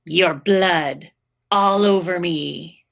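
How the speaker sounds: slow, eerie killer's line; creepy killer. Retold or imitated. Killer answering the frightened babysitter, slow and sinister. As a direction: drag it out like a menacing killer. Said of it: "he pauses and says".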